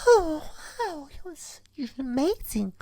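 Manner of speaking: very high, soft mock swoon; swooning woman impression. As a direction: imitate a woman gushing in a tiny high voice. Imitates the woman melting after kiss.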